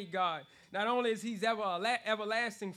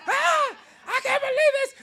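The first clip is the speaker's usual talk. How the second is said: high, loud mock freak-out; coworker freaking out; 10 dB louder than usual. Imitating a coworker flipping out at work. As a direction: shriek it like she lost it.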